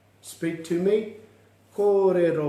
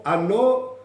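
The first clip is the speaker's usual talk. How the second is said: "Anō" higher with a rising finish; "Anō" as a clear upward call; voice going up; 4 semitones higher than usual. Shown as a demonstration. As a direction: ask for one more with a lifted "Anō". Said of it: "Hear the voice go up".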